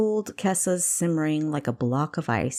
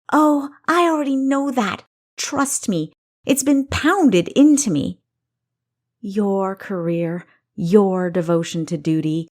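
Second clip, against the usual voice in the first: higher, louder, building rage; angry tirade; 4 semitones higher, 6 dB louder than usual. Acted. Kessa ranting at her sister's career, building loud anger. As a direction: pile the grievances on, louder each time.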